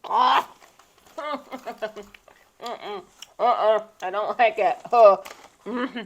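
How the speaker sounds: dramatic disgust at sour taste; loud, puckered reaction to sourness; sour face. Real, not acted. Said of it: "You're not as dramatic as me".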